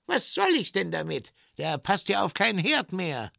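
high, fast protest; rapid, high-pitched objection; indignant tinker. Acted. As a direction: complain quickly in a high voice. Tinker quarrels with bear.

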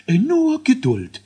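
high, squeaky scientist dwarf counting down; a fussy little boffin announcing launch; learned dwarf voice. A narrator's reading. Dwarf scientist starts the rocket countdown, high and squeaky. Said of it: "mahnte Elisius".

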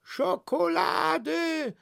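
high, drawn-out market cry; hoarse, slow, high-pitched hawking; hoarse vendor's cry. Acted. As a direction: cry your wares hoarsely, stretching every word. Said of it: "schrie der Verkäufer ganz heiser".

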